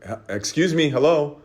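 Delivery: high, loud call of excuse me, hello. Retold or imitated. Chasing the woman with her water bottle, shouting. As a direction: shout to get her to turn around. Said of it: "So then I got louder".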